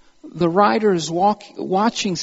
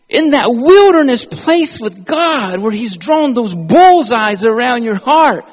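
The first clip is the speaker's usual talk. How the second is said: preaching at full force; 9 semitones higher, 11 dB louder than usual. Acted. Preacher crying out over transformed lives, high and loud. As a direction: pour it out over the congregation, near tears.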